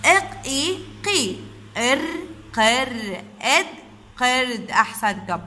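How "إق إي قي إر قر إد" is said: قرد built up from short separate sounds; one sound at a time, pausing before blending. Shown as a demonstration. Teacher introducing قرد to children, sound by sound. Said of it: "نيجي لكلمة".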